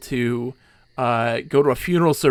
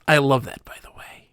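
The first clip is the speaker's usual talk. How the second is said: ordinary delivery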